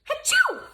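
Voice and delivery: high, loud acted sneeze; big sneeze. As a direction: let out a big cartoon sneeze. Amos wakes with a sneeze.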